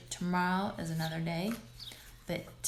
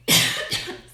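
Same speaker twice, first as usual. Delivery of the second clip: dry cough from dust; real cough; 9 dB louder than usual. Real, not acted. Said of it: "I'm gonna cough again".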